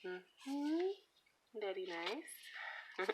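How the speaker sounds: put-on slow, high Puerto Rican accent with a hum; drawn-out Puerto Rican accent, very slow; auntie's accent. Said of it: "I hear her voice".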